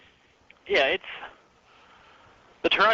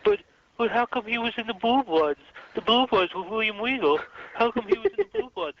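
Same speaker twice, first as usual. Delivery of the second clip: higher, whiny imitation of a clueless friend; mocking James; 7 semitones higher than usual. Mocking cohost James's confused question, whiny higher voice. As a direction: parrot his confused question in a silly voice. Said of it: "and James says".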